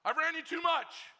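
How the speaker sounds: loud, high called reply; coach calling off. Retold or imitated. Coach shouting back that practice is over. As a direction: shout across the field. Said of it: "coach says".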